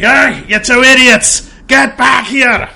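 loud, high, drawn-out holler; full-volume shout, stretching the words; yelling out. Acted. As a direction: yell to call them back from far ahead. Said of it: "I'm yelling out".

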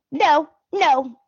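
high, mocking childish protest; mocking Brooke. Brooke refusing to accept the news, mocking high whine. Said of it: "because she's like".

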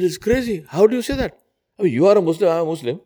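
high, indignant disbelief; man protesting to his neighbour, high and incredulous. Acted. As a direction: pitch it up in outraged disbelief.

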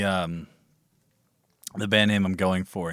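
ordinary talk, nothing marked

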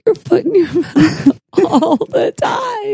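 laughing through the words, voice shooting up. Real, not acted. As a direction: burst out laughing while teasing. Teasing friend, bursts out laughing.

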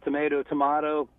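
"tomato, tomato" as tuh-MAY-toh, tuh-MAH-toh; "tomato, tomato" with "ay", then "ah"; two tomato pronunciations. Shown as a demonstration. Guest says either way works, "tomato, tomato" as MAY then MAH. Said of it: "Both ways are right".

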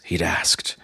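ordinary delivery

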